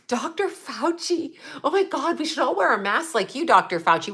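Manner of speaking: breathless adoring mock voice; mocking Julia Roberts. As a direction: parody a starstruck fan. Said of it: "She's like".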